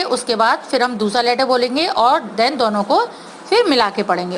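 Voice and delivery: usual speaking voice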